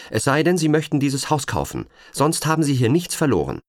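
high, loud agent's warning called up the stairs. Acted. Agent warns the intruder, calling out high and loud. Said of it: "rief sie".

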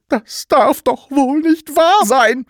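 high, loud, drawn-out outrage; scandalised high exclamation; outraged disbelief. A narrator's reading. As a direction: cry out slowly in appalled disbelief. She discovers the Sams under table.

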